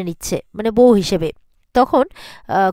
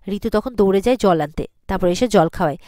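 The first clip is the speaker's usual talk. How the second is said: ordinary talk, nothing marked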